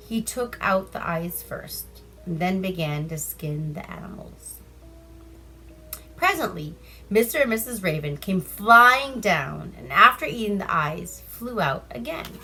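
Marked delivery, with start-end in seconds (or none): none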